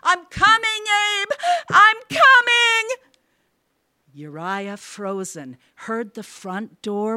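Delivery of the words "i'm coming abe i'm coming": very high, loud, tearful call; frantic calling. A narrator's reading. Mother runs down to her son, calling frantically. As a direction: call out desperately, louder on the repeat.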